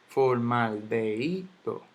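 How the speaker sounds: "Formaldehído" slowly broken up, for-mal-de-I-do; careful teacher's breakdown of "Formaldehído"; syllable by syllable. Shown as a demonstration. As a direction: sound out "Formaldehído" slowly, leaning on the I. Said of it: "vamos a analizar esta palabra".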